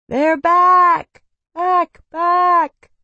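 high, loud call down a tunnel; shouting. A narrator's reading. Jamie calling through the tunnel on their return, shouting. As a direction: call out from afar, echoing. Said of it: "Jamie was shouting".

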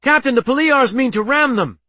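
urgent high, loud warning; shouted. A narrator's reading. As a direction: shout the alarm to your captain. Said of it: "shouted Yanuzi".

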